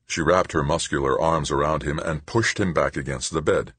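plain reading voice

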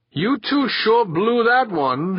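slow, high-pitched grumble; grumbled. A narrator's reading. Falker meets the returning pair at the airlock, grumbled. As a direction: drag it out in disgusted complaint. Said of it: "he grumbled".

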